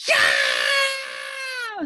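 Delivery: very high loud shriek of pain; girl shrieking in the classroom; piercing scream. Acted. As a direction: scream as if hair was yanked. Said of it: "screamed Leslie".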